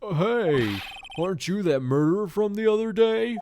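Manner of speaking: loud, gruff cop; Detective Gumshoe voice. Acted. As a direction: bark it like a blunt detective. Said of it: "my Detective Gumshoe voice".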